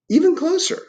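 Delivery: very high storybook call; the dark beckoning. Acted. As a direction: coax him nearer still. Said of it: "said the dark".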